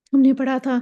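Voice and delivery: usual speaking voice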